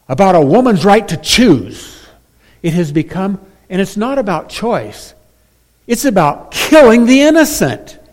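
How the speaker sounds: fired-up sermon rage; emotional outburst. Real, not acted. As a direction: build into indignant preaching. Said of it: "sorry if I feel emotional here".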